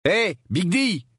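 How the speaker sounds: high, drawn-out mocking hail; calling out. Harry calls after his cousin. (interpellation moqueuse, aiguë et traînante)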